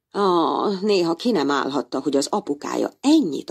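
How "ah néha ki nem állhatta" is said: ordinary delivery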